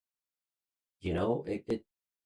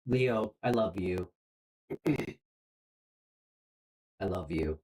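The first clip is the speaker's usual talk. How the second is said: choked up; 3 semitones lower than usual. Real, not acted. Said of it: "I haven't got choked up like that in a while".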